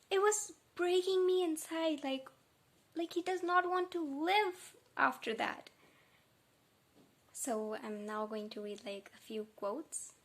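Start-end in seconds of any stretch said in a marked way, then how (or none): 0.1-5.7 pitched up high, voice catching with emotion; fighting back tears as you say it; voice breaking. Real, not acted. Emotional over Patroclus's devotion.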